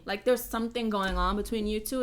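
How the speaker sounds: usual speaking voice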